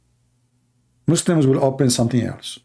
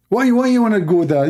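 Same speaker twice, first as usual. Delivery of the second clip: normal talking voice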